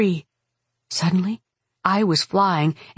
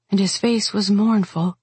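ordinary delivery, nothing marked

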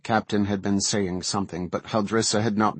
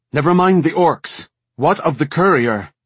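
loud, raised-pitch furious demand; 7 semitones higher, 8 dB louder than usual. A narrator's reading. Furious commander demanding news of the courier from her officer, angry and loud. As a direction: cut the officer off with angry force. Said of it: "Cowed by Haldrissa's fury".

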